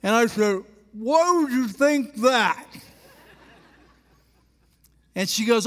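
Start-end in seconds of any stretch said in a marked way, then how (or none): 0.9-2.7 slow, mumbled words round a coin under the tongue; penny in mouth. Retold or imitated. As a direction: play innocent with a penny under your tongue. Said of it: "you talk weird when you have a penny in your mouth".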